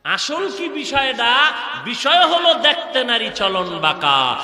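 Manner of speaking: loud, rousing waz cry, endings drawn out; rally the crowd with stretched, forceful phrases; full-force preaching. Real, not acted. Preacher defending his movement against opponents, full-force preaching.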